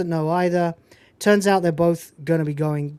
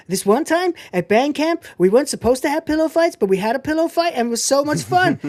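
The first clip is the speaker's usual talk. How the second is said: put-on much higher, fast babbling girl voice; chirpy band geek; 9 semitones higher than usual. High eager girl voice as Michelle rambles about band camp to Jim. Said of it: "His future wife, Michelle".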